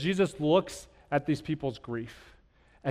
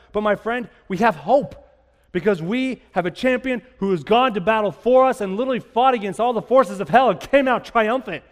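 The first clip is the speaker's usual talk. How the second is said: rising hopeful sermon, louder at the peak; preaching; 5 semitones higher, 5 dB louder than usual. Acted. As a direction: build to hope and proclaim it. Preaching hope at full force.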